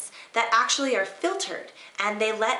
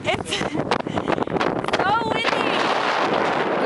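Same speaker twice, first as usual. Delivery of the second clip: excited; 12 semitones higher, 4 dB louder, much slower than usual. Real, not acted. Windy summit, excited.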